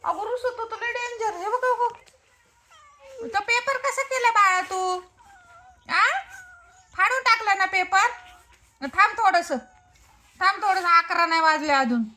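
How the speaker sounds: high, sing-song coaxing voice; high pitched pet talk, gently pleading. Real, not acted. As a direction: coax a sulking little pet to eat.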